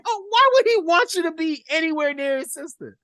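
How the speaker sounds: high-pitched, laughing disbelief; incredulous. Real, not acted. Host scoffing at Mike's offence, incredulous.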